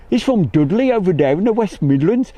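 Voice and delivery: put-on high, loud West Midlands accent; Dudley accent. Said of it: "is that a real bad accent?".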